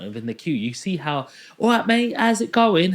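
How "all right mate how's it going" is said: high, loud, bright shop-staff hello; mock cheery greeting. Staff member welcoming people ahead in the queue, cheery. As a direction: greet a favoured customer, pitched high and loud.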